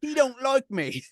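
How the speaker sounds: mock French accent, high, loud and slow. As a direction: drag out a hurt line in a put-on French accent. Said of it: "He's writing it like that, so I have to say it".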